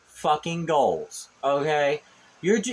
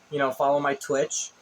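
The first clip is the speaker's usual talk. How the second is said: ordinary talk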